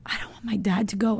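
whispering a heartfelt plea; whispered. Retold or imitated. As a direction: breathe it out in a whisper. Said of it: "I was like".

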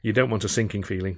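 ordinary delivery, nothing marked

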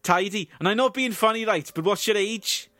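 high, loud Welsh officer's voice; a comic Welsh accent, pushed high. Acted. Welsh accent for the officer asking Barry's age. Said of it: "performing their dialogue in a Welsh accent".

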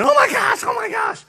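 high panicked shrieks; screaming. Retold or imitated. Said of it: "you hear everybody screaming".

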